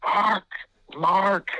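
mock a coach calling again and again for the ref; retold yelling. Coach keeps yelling for the official across the court, mock yelling. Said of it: "Greg is just yelling".

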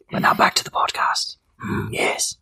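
breathy whispered aside; hushed mock announcer aside. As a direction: whisper it like a secret to the mic.

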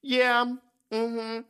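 mocking a younger self, high and carefree; mock agreement. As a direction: play your clueless younger self agreeing. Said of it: "you was just like".

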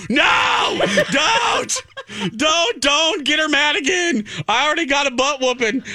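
high, urgent pleading, rushed at the end; mock panicked plea. Host warning coworker not to anger the boss, mock-panicked high plea. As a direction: beg them not to stir it up. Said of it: "I go".

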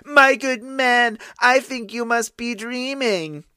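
suppressed amusement. Acted. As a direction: toy with him, amused. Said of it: "she could hardly keep a straight face".

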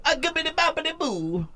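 very high cartoon Italian accent; mock Italian babble, hammed up; mock Italian gibberish. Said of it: "people that go".

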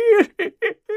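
high villainous cat laugh; gloating villain laughter; evil cackle. Acted.

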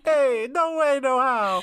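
put-on high-pitched, botched New Jersey accent; mock New Jersey accent. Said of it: "I don't know what accent that was".

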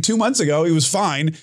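ordinary talk, nothing marked

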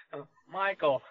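put-on New England accent. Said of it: "That's how he talks".

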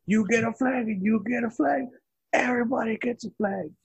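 high, gushing giveaway voice, way up in pitch; Oprah impression. Cohost mimicking Oprah's giveaway, high gushing voice. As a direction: belt it like a talk-show host handing out prizes. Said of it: "Like Oprah".

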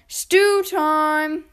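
loud, high call to the cubs. A narrator's reading. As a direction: summon everyone to dinner. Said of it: "When Papa cried".

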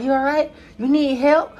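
high mock concerned voice; lightly voiced pitying women. Women watching him carry speakers, high mock concern. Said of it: "They was like".

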